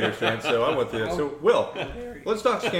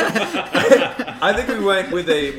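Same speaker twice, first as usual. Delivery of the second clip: laughing through the words, slow and louder; 9 semitones higher, 10 dB louder, slower than usual. Real, not acted. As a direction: break into laughter while answering. Laughing mid-answer.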